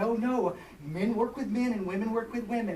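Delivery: lightly voiced young woman correcting him; young woman's voice. Girl's retort quoted.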